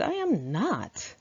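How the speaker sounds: slow, drawn-out denial; indignant denial. Retold or imitated. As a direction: stretch the denial out slowly. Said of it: "I said".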